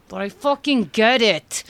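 dragging it out, sick of the book; exasperated. Retold or imitated. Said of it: "There was a whole middle that I'm like".

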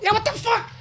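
very high, hammed-up indignation; fake outrage. Thief outraged about people stealing your wallet, mock indignant. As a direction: play a thief pretending to be appalled, voice way up.

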